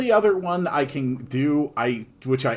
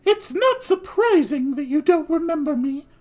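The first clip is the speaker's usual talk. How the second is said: high falsetto woman's voice; female voice; 13 semitones higher, 4 dB louder than usual. Acted. Returning noblewoman to the shopkeeper, high falsetto. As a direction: modest lady, sung up high. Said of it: "the most consistent quote-unquote female voice".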